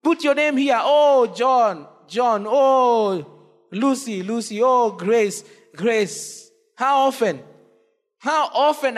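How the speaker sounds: Jesus calling sinners by name; pleading call. Acted. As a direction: call each name like a longing father. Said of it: "Jesus extends his hand to you".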